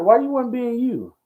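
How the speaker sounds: high-pitched imitation of a pastor's challenge; challenging question. Pastor questioning his son in ministry, challenging.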